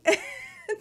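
real laugh that breaks high; cracking up at the memory. Real, not acted. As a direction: burst out laughing. Laughs at her own story.

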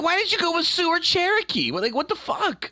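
high, incredulous mock outrage at the filmmakers; exasperated question. Griping about the movie's casting to a friend, incredulous high squeak. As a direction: squeak it out in disbelief. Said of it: "So I was like".

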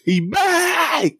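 high, drawn-out cheer; excited shout. Acted. Acting out fans hyped at a battler's return, excited shout. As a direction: shout it out high, stretching the last word.

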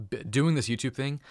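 ordinary talk

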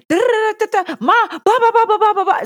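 high, loud mock tirade of babble; mimicked high-pitched venting; imitated snapping rant. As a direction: rattle off nonsense like a kid going off. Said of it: "snapping. My baby is going".

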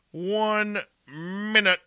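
high and slow, stretching "One minute"; drawn-out sing-song. Pretend. Host counting down to air.

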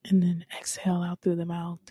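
whispered breathing cue; calm whispered guidance; soft whisper. Shown as a demonstration. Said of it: "guiding breathing exercise".

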